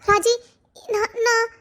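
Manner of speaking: halting, tearful confession; high, upset voice with broken starts. Acted. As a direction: stammer it out close to crying. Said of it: "எதுக்கு அழுதுட்டு இருக்க?".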